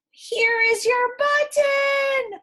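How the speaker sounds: high, slow triumphant cry; excited call. Acted. Frog calling to Toad in triumph, loud and high. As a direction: call it out like a big discovery. Frog calls out finding button.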